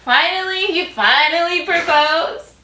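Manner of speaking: amused surprise. Retold or imitated.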